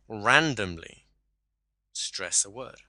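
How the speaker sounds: "randomly" stressed hard, then a pause before "stress a word". Shown as a demonstration. Coach slipping an example into normal talk, stress on "randomly". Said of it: "Just did it then, for example".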